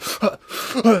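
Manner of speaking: acted gasp after being choked; mimed strangled intake of breath; gasp for air. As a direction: suck in air sharply. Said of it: "suck in a little bit of air".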